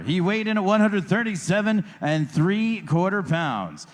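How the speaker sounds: usual speaking voice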